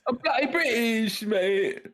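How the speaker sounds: playful cockney-ish British voice; mock British bloke; British accent. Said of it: "kept talking with a British accent".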